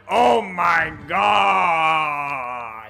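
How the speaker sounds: loud, drawn-out cartoon villain shout; Wario. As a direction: belt it out as a cartoon character. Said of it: "in my Wario voice that I'm famous for".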